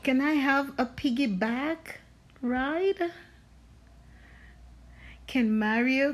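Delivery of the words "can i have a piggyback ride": plain reading voice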